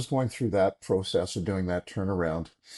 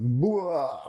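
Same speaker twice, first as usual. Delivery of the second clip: loud, higher-pitched mock outpouring; spilling-out blah; 8 semitones higher, 3 dB louder than usual. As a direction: blurt a big drawn-out "blah". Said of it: "And then I just went".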